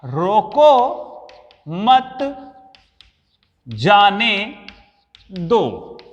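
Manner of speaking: slow, spaced out with long pauses between words; deliberate dictation pace; word-by-word reading. Shown as a demonstration. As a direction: read it out one word at a time, as if writing it. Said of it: "ध्यान दीजिएगा".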